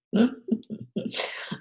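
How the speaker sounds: a short unvoiced laugh at her own expense; breathy laugh at herself. Real, not acted. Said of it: "I'm laughing 'cause that's my own damn fault".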